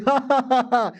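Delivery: high gloating cackle; villain laugh. Acted. Villain gloats over controlling the girl, gloating laugh.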